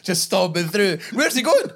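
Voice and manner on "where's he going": high, loud surprised cry; startled question. Retold or imitated. Mimicking a bystander wondering where the fan went, surprised. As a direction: squeak it out in disbelief. Voicing confused onlooker.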